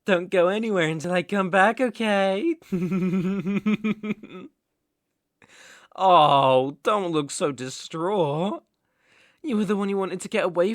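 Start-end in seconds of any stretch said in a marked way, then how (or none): 2.6-4.5 long rapid giggle; taunting chuckle. Acted. Captor leaving captive until he returns, taunting giggle. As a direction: giggle on and on, pleased with yourself. Laughs at captive left alone.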